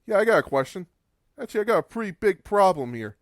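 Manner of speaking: hammed-up Triple H sneer, fast at the end; imitating Triple H. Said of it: "Triple H would look at him and say".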